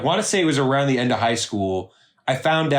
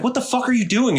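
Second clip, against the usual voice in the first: high-pitched indignant demand; retold confrontation; 9 semitones higher than usual. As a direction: replay an incredulous challenge, pitched up. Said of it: "sent her a message like".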